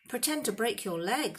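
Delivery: 1 urgent suggestion. A narrator's reading. Hermione suggesting.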